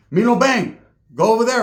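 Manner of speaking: calling out orders. Retold or imitated. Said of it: "They'd be like".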